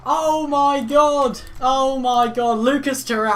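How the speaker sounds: very high, very loud goal celebration; ecstatic screaming. Real, not acted. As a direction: scream it like the winner just went in. Fan celebrating a bicycle-kick goal.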